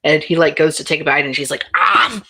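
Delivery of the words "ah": loud, higher "ah"; a short, loud open-mouthed ah; mimed open-mouth "ah". Acted. Opens mouth for the bite.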